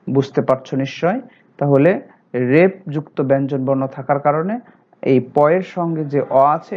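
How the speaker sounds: ordinary talk, nothing marked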